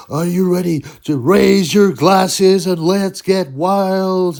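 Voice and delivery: slow, loud, high ring announcer voice; announcer catchphrase. Retold or imitated. Imitating Big Mo's ring catchphrase, slow and booming. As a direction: draw out every word like a showman. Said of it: "So Big Mo said".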